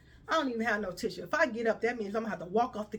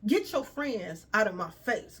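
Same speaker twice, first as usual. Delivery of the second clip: ordinary talk, nothing marked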